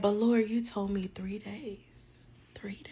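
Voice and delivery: an unvoiced, slow whisper; whispered inner thought. Praying in her head at the exam, whispered. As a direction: whisper it like a secret prayer. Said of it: "in my head".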